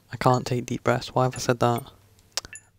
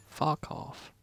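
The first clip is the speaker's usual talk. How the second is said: whispered curse; 3 semitones higher, 9 dB quieter than usual. Real, not acted. Frustration muttered under the breath.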